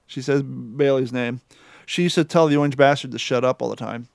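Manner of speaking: ordinary talk, nothing marked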